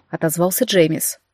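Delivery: usual speaking voice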